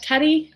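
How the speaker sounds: "Taddy" tried with a rising, questioning pitch; sounding out "Taddy" uncertainly; testing pronunciation. Shown as a demonstration. Beauty vlogger unsure of a name, tries "Taddy" questioningly. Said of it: "Is that how you say it?".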